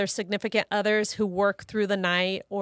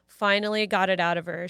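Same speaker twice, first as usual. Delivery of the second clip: normal talking voice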